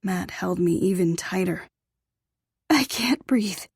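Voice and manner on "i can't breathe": gasped. A narrator's reading. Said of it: "I gasped".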